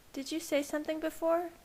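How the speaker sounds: a hint of a curious girlfriend; Bella's voice. A narrator's reading. Lightly voiced Bella questioning her partner.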